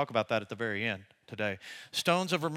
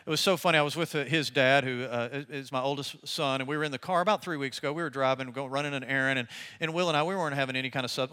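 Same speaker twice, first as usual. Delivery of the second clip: usual speaking voice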